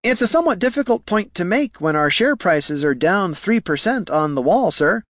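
high-pitched, hesitant objection; nervous junior clerk. Acted. Clerk objecting to his bosses' instruction, nervous. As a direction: object politely in a high, anxious voice.

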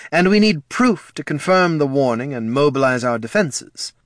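urgent official. A narrator's reading. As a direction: report the threat firmly.

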